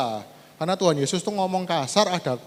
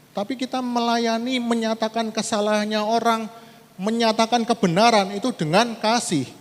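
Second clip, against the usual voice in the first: preaching at full force; 7 semitones higher, 4 dB louder than usual. Acted. Preacher reading Galatians aloud to the church, loud and forceful. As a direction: proclaim the verse to the congregation.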